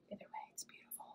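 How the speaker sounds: hushed whisper, almost no voice; whispered to herself, admiring the crow. Real, not acted. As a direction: breathe it out in quiet awe. Admiring crow incense holder.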